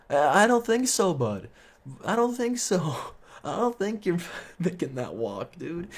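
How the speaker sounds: high-pitched, teasing doubt; amused high voice, not buying it; mocking disbelief. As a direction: pitch it way up, humoring a drunk buddy. Mocks drunk friend's claim to walk.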